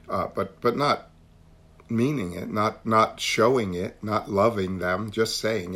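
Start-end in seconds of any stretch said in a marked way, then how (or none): none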